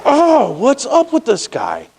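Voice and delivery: high, drawn-out exasperation; exasperated complaint. Retold or imitated. Driver cut off again beside his passenger, exasperated. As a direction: stretch the "oh" high, then complain slowly.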